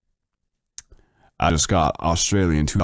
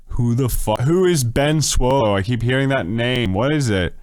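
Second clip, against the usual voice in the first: mock exasperation; 7 semitones higher than usual. Streamer baffled by a name chat keeps mentioning, high exasperated wail. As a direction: wail the question upward in exasperation.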